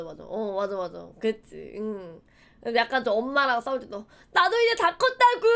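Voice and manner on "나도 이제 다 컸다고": high, loud protest at mom; mock teen tantrum. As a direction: act out a whiny outburst, loudly. 엄마에게 대드는 어린 자신. (엄마에게 높고 크게 대드는 사춘기 흉내)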